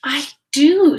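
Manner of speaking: bright, high, loud little "I do"; a child's eager reply; eager answer. A narrator's reading.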